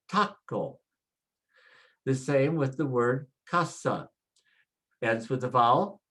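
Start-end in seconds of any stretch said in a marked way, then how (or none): 0.1-0.8 taco with the TA leaning heavy; stress on first syllable of taco. Shown as a demonstration. Teacher shows learners where Spanish stress falls, stressing taco's first syllable. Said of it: "put the stress there and say".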